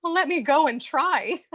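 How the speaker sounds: light laugh through words. Acted. Cressida laughs while leaving.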